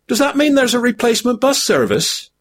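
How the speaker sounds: high and fast, anxious hobbit; hurried high-pitched Sam. A narrator's reading. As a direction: squeak the question out quickly. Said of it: "asked Sam".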